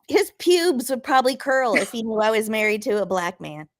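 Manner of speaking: ordinary delivery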